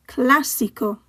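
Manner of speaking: Clásico with CLÁ stressed; stress on accented syllable. Shown as a demonstration. Teacher modelling written accents, Clásico stressed on first syllable. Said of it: "stress the syllable that the accent is on".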